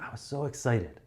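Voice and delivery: a touch of enthusiasm; a bit excited. Shown as a demonstration. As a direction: keep it modest, for a small room. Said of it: "If it's fifteen people, you might be like".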